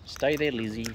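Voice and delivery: normal talking voice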